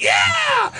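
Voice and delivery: high shouted cheer; mock crowd roar.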